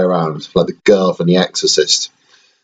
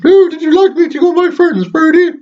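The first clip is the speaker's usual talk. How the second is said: high squeaky toy voice, raised and bright; teddy voice; 4 dB louder than usual. Pretend. Dad voicing teddy Toronto to his baby son, high toy voice. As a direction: squeak it out as the little bear.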